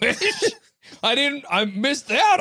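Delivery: high laughing answer. Real, not acted. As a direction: giggle through the excuse.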